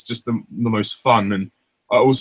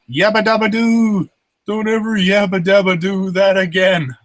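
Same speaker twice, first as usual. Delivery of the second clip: cartoon catchphrase; 9 semitones higher, 5 dB louder than usual. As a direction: belt the catchphrase. Quoting film line.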